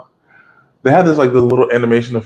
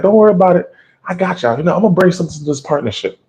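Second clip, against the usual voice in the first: Buggy's bragging voice; 8 semitones higher, faster than usual. Retold or imitated. Buggy reassuring his partners.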